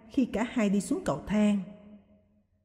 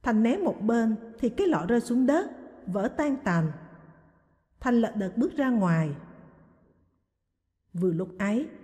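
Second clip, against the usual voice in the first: plain reading voice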